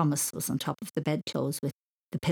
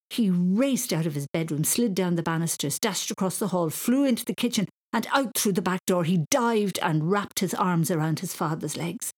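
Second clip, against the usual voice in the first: ordinary delivery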